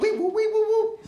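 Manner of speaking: high squeaky whoops, like a wiper on a whiteboard; squeaky wipe noises. Retold or imitated. Squeaky wiping sounds while clearing the vision board.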